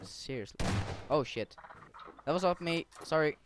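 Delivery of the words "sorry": ordinary talk, nothing marked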